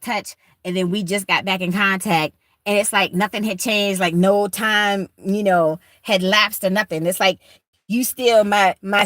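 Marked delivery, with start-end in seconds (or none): none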